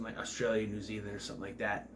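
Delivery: normal talking voice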